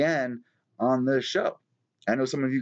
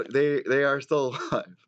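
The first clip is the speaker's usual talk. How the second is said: laughing. Real, not acted. Laughs checking fish alive.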